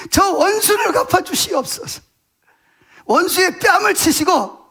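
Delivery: high, slow, drawn-out plea to God; fervent pleading prayer. Acted. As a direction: act out David begging for vengeance. Acting David's fervent psalm prayer.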